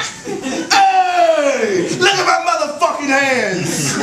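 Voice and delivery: mock yell. Poet acting out yelling at the officer, laughing shout. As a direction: holler it at the cop, half laughing.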